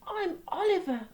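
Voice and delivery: ordinary delivery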